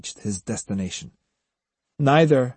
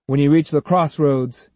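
ordinary delivery, nothing marked

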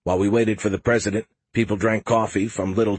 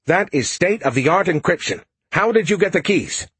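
higher, louder, shocked official; alarmed advisor; 9 semitones higher, 4 dB louder than usual. Acted. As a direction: demand it in disbelief.

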